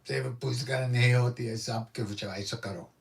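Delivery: imitated guru's pickle-sour rebuke in Hindi; quick, disgusted outburst after a sour "ah". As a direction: dismiss it as if you bit a pickle. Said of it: "looked like he bit a pickle".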